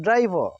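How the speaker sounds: ड्राइवर run together, ending "vaa"; American silent R. Shown as a demonstration. Teacher showing connected speech, silent R. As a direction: say ड्राइवर as one word without the R. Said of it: "जब इसको हम जल्दी से बोलेंगे".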